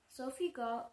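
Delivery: plain reading voice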